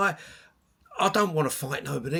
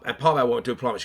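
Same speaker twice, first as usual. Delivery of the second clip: ordinary delivery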